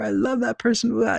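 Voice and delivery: high-pitched exaggerated gushing; mock adoration. Imitating people who love a polarizing person, hammed-up adoration. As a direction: squeal it like an overexcited fan. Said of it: "like, love or n-, not love them".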